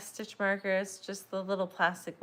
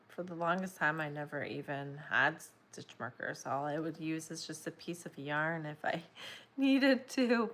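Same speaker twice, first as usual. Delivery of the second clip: ordinary talk, nothing marked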